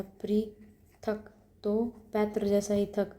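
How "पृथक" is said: पृथक drawn out very slowly, each sound apart; पृथक spoken very slowly for the class; slow spelling demo. Shown as a demonstration. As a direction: stretch पृथक out syllable by syllable. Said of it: "पृथक होता है".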